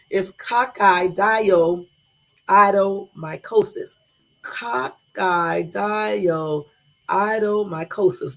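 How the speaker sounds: coccidioidomycosis stretched very slow, each syllable spelled out; slow careful pronunciation. Shown as a demonstration. Streamer finally giving viewers the correct name slowly. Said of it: "That's the name of it".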